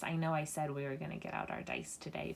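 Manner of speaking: ordinary talk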